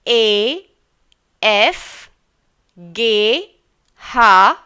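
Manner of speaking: each German letter called out loud and slow; teacher drilling the German ABC aloud; German alphabet recital. Shown as a demonstration. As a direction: recite the alphabet loudly for pupils to repeat. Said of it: "आप भी बैठे बैठिए मेरे साथ इसको दोहराइएगा".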